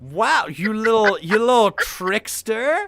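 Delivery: loud, high, drawn-out playful scolding; big teasing "you got me" outburst; mock outrage. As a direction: stretch it out in amused, exaggerated disbelief. Playful outrage at game child's trick.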